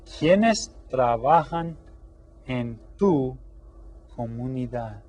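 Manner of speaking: deliberate classroom Spanish; slow model question. Shown as a demonstration. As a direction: spell it out slowly for the class. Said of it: "Okay, so".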